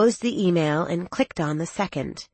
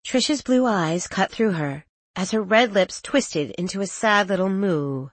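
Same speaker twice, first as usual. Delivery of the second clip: usual speaking voice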